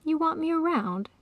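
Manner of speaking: high, incredulous question; lifted voice, full of disbelief; disbelieving. A narrator's reading. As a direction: ask it as if you can't believe it. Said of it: "she asked in disbelief".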